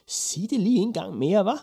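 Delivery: put-on high, loud, incredulous old witch; startled disbelief. A narrator's reading. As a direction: demand a repeat in shrieky astonishment. Startled witch asks to hear again.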